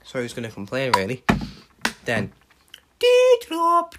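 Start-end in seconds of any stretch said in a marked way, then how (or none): 3.0-3.9 very high, loud squeal; squealed name. Retold or imitated. A fan squealing Doudrop's name as she enters. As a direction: shriek the name out.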